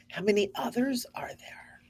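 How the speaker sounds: whisper. Real, not acted. Wondering aloud, hushed.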